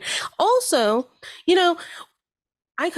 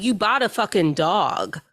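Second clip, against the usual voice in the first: usual speaking voice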